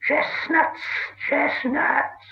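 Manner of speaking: high hissed shriek, twice; hissed answer. A narrator's reading. Gollum answering Bilbo's riddle, high hissing cry. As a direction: spit the answer out gleefully. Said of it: "he hissed".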